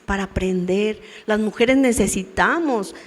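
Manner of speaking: ordinary talk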